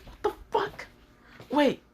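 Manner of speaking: high, breathy disbelief; shocked squeak. Acted. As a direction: react like the threat just flopped. Gunman baffled by ignored threat.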